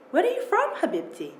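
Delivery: a hint of a heavy foreign accent, kind and curious; gentle heavily accented English, savouring the words. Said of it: "heavily accented English fills the room".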